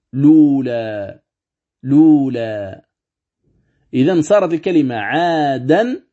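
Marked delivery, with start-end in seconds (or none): 0.1-2.9 slow "لولا" with lam voweled; slow recitation. Shown as a demonstration. Teacher shows resulting word, slow recitation. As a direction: draw out "لولا". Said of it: "ماذا تصير الكلمة".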